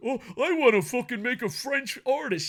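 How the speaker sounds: high, whiny mock voice; mocking imitation. Reviewer mimicking Zack Sabre Junior, mocking imitation.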